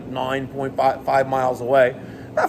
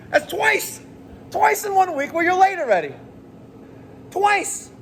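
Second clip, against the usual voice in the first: exasperated scolding; 12 semitones higher than usual. Real, not acted. Scolding employee for lateness.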